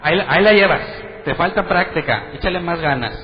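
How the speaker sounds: loud cheering coach, pushing harder; pastor's coaching. Retold or imitated.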